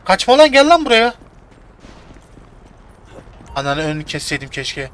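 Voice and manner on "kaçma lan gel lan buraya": yelling. Real, not acted. Chasing the man, yelling at him.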